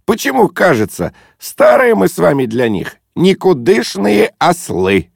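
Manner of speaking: high, lively, jovial declamation; breezy overriding. A narrator's reading. Guest lumping himself in with old fools, breezily. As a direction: talk right over him with gusto.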